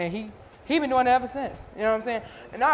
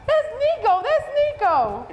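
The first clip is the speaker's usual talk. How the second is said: high, loud, slow excited cry; excited recognition; 14 semitones higher, 4 dB louder, much slower than usual. Retold or imitated. Assistant thrilled to recognize the singer. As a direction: gasp out the name, thrilled, much higher. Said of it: "she was like".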